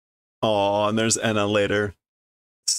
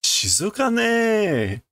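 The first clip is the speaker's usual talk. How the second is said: calm remark; 11 semitones higher, much slower than usual. Acted. Voicing Asagi in Okinawa.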